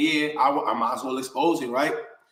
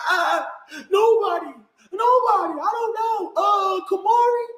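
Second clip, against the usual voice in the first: put-on much higher, shouted child's cry; imitating a crying child; 13 semitones higher than usual. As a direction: mimic a scared little girl sobbing out answers. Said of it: "My daughter crying".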